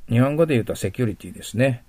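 ordinary talk, nothing marked

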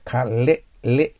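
かれ said as "ka-le", L isolated; clear L. Shown as a demonstration. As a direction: press the tongue behind the teeth for れ in かれ. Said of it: "Lにするときはね".

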